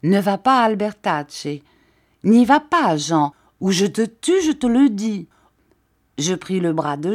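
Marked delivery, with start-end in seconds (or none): 0.0-5.3 loud, higher angry threat; threatening shout. A narrator's reading. Mathieu threatening to kill Jean over a girl. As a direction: threaten him, building to a yell. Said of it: "cria-t-il".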